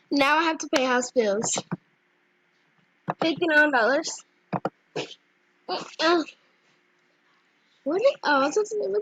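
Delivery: ordinary talk, nothing marked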